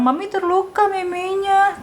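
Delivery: high, lilting mock complaint; plaintive mommy voice. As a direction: act hurt for a toddler, pitched high. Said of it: "aku bilang". (suara ibu pura-pura kesakitan, tinggi)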